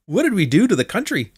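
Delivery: exasperated disbelief at what you heard; appalled reaction. Retold or imitated. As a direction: lament it in exaggerated despair. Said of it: "And I was like".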